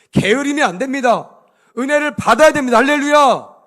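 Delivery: loud, high preacher's exhortation; rallying cry. Real, not acted. Preacher warning the congregation against laziness, loud rallying cry. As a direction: drive it home and ring out the hallelujah.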